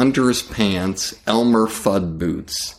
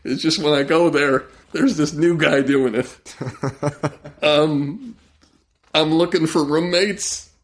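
high, jittery comic voice; nervous high-voiced oddball; twitchy high-pitched Bobcat bit; Bobcat's voice; 6 semitones higher than usual. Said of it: "he's like".

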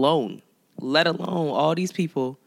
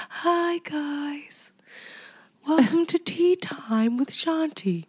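put-on high breathy kids' show host; soft sing-song welcome, slow; Shanti's soft voice; 11 semitones higher, slower than usual. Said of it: "You sound like one of those people".

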